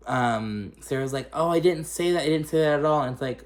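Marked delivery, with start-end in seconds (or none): 1.3-3.0 higher-pitched mock protest; protesting denial. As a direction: act out a defensive denial, pitched up. Acted denial in retold argument.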